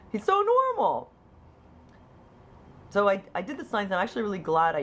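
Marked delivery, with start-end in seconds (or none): 0.1-1.1 very high, louder self-imitation; hammed-up denial, pitched way up; mock insistence. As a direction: protest it in a squeaky-high voice. Voicing her own past denial.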